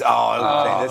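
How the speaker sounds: ordinary talk, nothing marked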